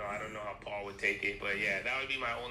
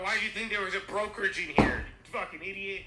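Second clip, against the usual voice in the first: high, loud jeering question; mock scorn; 8 semitones higher, 7 dB louder than usual. Tormentor mocking the new arrival in hell, jeering. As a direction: taunt the fool in pretend contempt. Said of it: "They're like".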